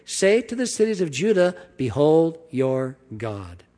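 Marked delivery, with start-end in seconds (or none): 1.8-3.6 "Behold" drawn out and falling; slow proclamation. Acted. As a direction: announce it slowly, like a herald. Proclaiming scripture slowly.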